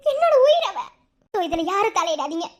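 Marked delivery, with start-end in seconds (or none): 0.1-0.8 anguished cry. Acted. Mother cries out for her daughter.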